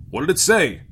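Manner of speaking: startled captain insisting; urgent demand. A narrator's reading. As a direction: demand an answer firmly. Captain demands, first time.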